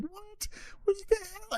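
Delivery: high, squeaky burst of disbelief; shocked disbelief. Retold or imitated. Telling the listener how he reacted to the lawyer, in shocked disbelief. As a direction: yelp it out as if you can't believe your ears. Retelling shock at Israeli satellite.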